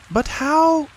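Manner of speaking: very high little joey voice; bright eager baby kangaroo, way up high; Roo. A narrator's reading.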